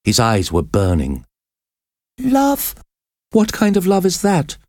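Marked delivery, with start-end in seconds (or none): 2.2-4.6 very high, indignant outcry; crying out. A narrator's reading. Prisoner throws the priest's love back at him, crying out. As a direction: cry it out high, in disbelief. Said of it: "Esmeralda cried".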